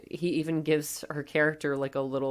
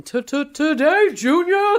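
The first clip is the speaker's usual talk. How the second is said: high, stuttering mocking yell; Billy Madison; 13 semitones higher, 9 dB louder, much slower than usual. Billy yelling at a stuttering kid, mocking yell. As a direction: yell it, mocking the stutter, last word blasted. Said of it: "Billy yells at him".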